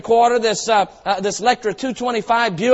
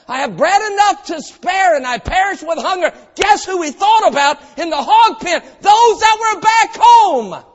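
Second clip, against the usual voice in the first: high, loud, slowing shout; preaching at full force; 7 semitones higher, 5 dB louder than usual. Real, not acted. Preacher pressing the congregation, building to a shout. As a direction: drive it home like a revival preacher.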